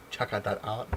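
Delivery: playful self-mockery with a lifted ending; mocking own slip. Vlogger echoing his garbled sign-off, playful self-mockery. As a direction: repeat the fumble in a put-on voice, rising at the end.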